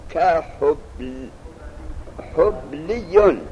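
"حبلیون" slowly, syllable by syllable, with a doubled y. Shown as a demonstration. Teacher showing students the shadda, "حبلیون" with a doubled y. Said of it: "یارا تشدید بده".

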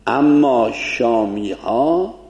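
ordinary delivery

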